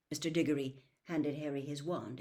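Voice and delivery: ordinary delivery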